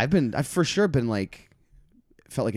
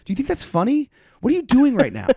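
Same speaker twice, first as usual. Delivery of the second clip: high-pitched, louder incredulous outrage; retold anger; 6 dB louder than usual. Comic confronts man putting on sunglasses, high incredulous anger. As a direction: reenact being mad at a heckler. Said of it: "I was so mad".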